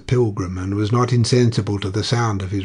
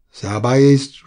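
grave earnestness; 4 semitones higher, 8 dB louder, slower than usual. A narrator's reading. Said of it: "with grave earnestness".

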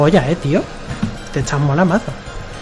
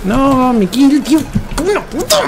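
outraged yell; 12 semitones higher than usual. Real, not acted. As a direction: shout it, the kill was stolen. Kill stolen, yells.

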